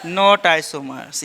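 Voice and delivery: very slow, each word stretched for the class to repeat; slow drawn-out answer. Shown as a demonstration. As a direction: draw it out like a chant for students. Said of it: "क्या इसमें होगा?". (बहुत धीरे, खींचकर बोला गया जवाब)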